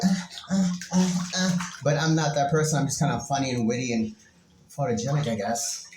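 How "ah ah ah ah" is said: higher mock model gasps; lightly hammed-up glamour poses; posing for photos. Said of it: "she's all like".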